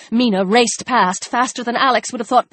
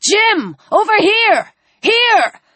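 high, urgent calls; climbing calls for attention; shouting; 9 semitones higher, slower than usual. A narrator's reading. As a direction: yell to turn him round. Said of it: "shouted the man with the rifle".

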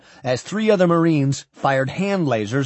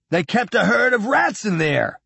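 shouted; 8 semitones higher than usual. A narrator's reading. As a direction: cry out in alarm at a high pitch. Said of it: "Cargill shouted".

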